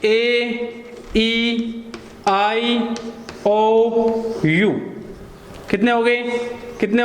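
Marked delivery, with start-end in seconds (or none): 0.1-4.7 each vowel spaced out with pauses; listing vowels. Shown as a demonstration. Teacher listing English vowels one by one. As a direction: count off each vowel slowly. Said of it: "कौन कौन से होते हैं?".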